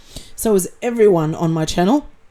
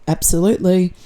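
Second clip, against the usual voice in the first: ordinary talk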